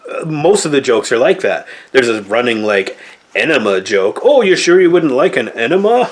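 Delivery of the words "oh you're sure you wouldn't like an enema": mocking movie line. Mocking the running enema joke.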